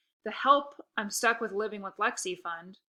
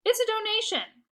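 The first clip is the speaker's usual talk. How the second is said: much higher insistent explanation; host justifying the fee, higher; explaining; 13 semitones higher, 6 dB louder than usual. Acted. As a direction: explain it as if obvious. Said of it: "I explained".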